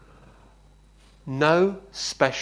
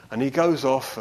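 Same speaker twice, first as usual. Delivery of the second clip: ordinary talk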